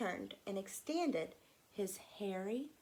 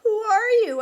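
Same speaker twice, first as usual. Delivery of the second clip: high, loud startled question; startled child; 12 semitones higher, 16 dB louder than usual. Acted. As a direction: blurt it out in alarm. Startled boy meets creature.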